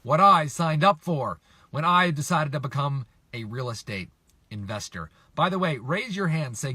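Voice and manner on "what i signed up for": louder, retold exasperation; a touch of exasperated protest; mildly frustrated. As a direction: lightly re-enact fed-up frustration. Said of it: "I'd be really frustrated".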